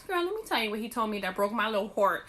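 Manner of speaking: ordinary talk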